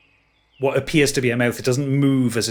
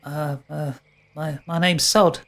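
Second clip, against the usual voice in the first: put-on shy high boyish voice; 4 semitones higher, slower than usual. GM voices shy satyr boy.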